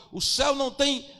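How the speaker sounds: loud, high fervent preaching; preaching at full force. Acted. Pastor rousing the congregation to evangelize, shouting with fervor. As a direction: shout the call to work like a revival preacher.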